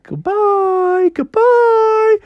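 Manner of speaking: very high, drawn-out goodbyes; fading farewell. Acted. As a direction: sing out farewell while floating off. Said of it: "he slowly faded and faded".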